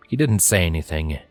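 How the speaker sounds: ordinary delivery, nothing marked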